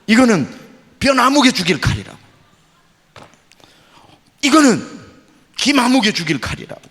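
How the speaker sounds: loud, high, menacing threat. Retold or imitated. The pastor voices the man naming victims, loud and menacing. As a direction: spit out each name like a threat.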